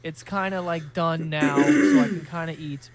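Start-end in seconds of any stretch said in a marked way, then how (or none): 1.6-2.1 voice cracking. Real, not acted. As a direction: voice cracks up high and loud. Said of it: "wow, my voice cracked".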